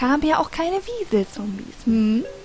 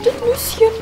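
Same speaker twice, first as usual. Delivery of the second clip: high cute voice; 12 semitones higher, slower than usual. Pretend. Cute voice for the nuts.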